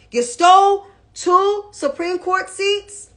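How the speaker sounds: high, loud and accusing; real indignant accusation. Real, not acted. Commentator accusing the senator of stealing seats, indignant.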